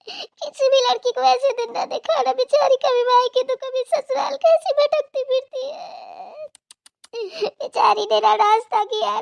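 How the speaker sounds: high, loud sobbing complaint; tearful mother crying over daughter; sobbing lament. Acted. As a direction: sob and wail over the poor girl. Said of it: "आपको रोना नहीं है बिल्कुल".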